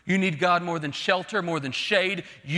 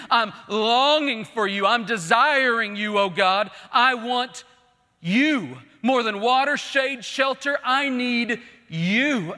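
impassioned plea, louder and higher; crying out; 4 semitones higher, 4 dB louder, slower than usual. Retold or imitated. Preacher paraphrases David's cry to God, pleading aloud. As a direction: draw out each longing phrase, building. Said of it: "he cries out".

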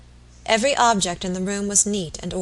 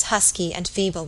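usual speaking voice

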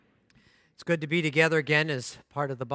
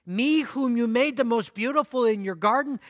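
higher, pleading disbelief; horrified protest; 8 semitones higher, 7 dB louder than usual. Acted. Bamboo protesting to its master, high horrified plea. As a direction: remind him how beautiful you are, aghast. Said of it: "A trembling of great horror shook bamboo".

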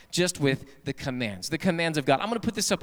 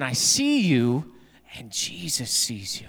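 slow, eerie warning; 3 semitones lower, slower than usual. Acted. Parrot repeats warning.

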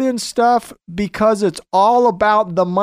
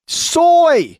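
emphatic reveal; 7 semitones higher, 3 dB louder than usual. Real, not acted. Answering own question, emphatic reveal.

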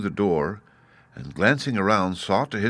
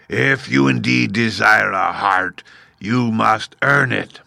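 slow, louder gruff beast voice; 3 semitones higher, 5 dB louder, slower than usual. Acted. Beast Oz telling the Woodman, gruff and slow. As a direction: growl it out slowly. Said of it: "but said gruffly".

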